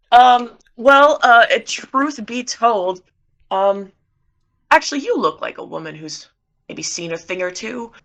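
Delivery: flustered. Acted. Cosmo flustered flattery.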